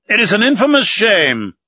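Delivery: loud, high outraged cry; Ned bursting out in fury; angry cry. A narrator's reading. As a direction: shout it out in indignation. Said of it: "cried Ned Land".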